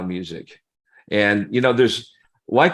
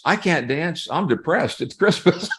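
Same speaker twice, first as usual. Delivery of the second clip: higher-pitched, put-upon protest; mock protest; 6 semitones higher than usual. Lone diner refusing to dance on Christmas Eve, higher mock protest. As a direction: act out a depressed refusal.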